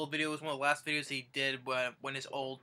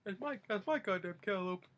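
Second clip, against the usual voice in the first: higher voice, held back in volume; mild mock anger; 6 semitones higher, 4 dB quieter than usual. Grandpa griping about his cantaloupe, understated mock anger. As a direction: imitate the complaint without the yelling. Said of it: "He's like".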